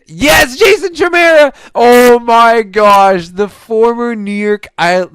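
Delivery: screaming high and loud, stretching it out; hoarse victory scream; ecstatic goal shout. Real, not acted. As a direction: explode as the goal goes in. Buzzer-beater goal celebration.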